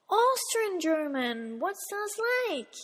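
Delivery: drawn-out, incredulous classmate; mock puzzlement. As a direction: imitate a baffled classmate, stretching the words. Voices puzzled classmates' reaction.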